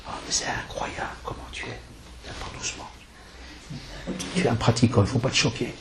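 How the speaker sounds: very soft, mostly whispered mock sweetness; sweet little girl voice. Girl flattering a practising boy at school, mock-sweet soft whisper. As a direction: imitate a girl cooing softly, almost in a whisper. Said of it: "avec la petite voix toute douce".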